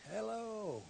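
distant calling voice; faint hello from across the lake; far-off call. Retold or imitated. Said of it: "hears a voice way off in the background calling".

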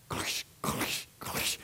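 hushed, voiceless "clush" bursts; imitated noise. Preacher mimicking a noise at the flooded church, voiceless bursts. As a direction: make short whispered crackling noises with the mouth.

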